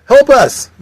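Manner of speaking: high, loud plea; acted panicky call; cry for help. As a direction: yell for someone to rescue you. Calling for rescue.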